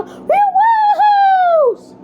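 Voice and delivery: long, high drawn-out woohoo; excited cheer. Acted. Cheering the team's win, a high, slow excited whoop. Cheering after winning the challenge.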